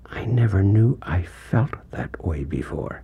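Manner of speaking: slow, hushed, whispered wonder; the mother hearing herself on tape, whispering; amazed whisper. Retold or imitated. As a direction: let the discovery come out in a breath. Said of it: "and suddenly says".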